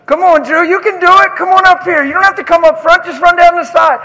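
high, loud encouraging call; eager coaxing. Real, not acted. Preacher urges Drew to come up, loud eager coaxing.

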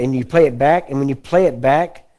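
ordinary delivery

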